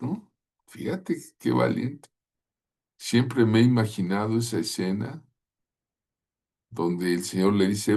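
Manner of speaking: ordinary talk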